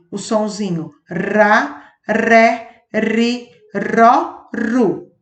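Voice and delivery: ra, ré, ri, ró, ru, each with a light tapped r; soft r syllables. Shown as a demonstration. Teacher showing pupils the weak r sound, syllable by syllable. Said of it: "ele fica com um sonzinho fraco".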